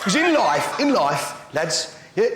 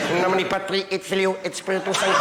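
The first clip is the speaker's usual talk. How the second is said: mock Pope blessing. Pope saying grace.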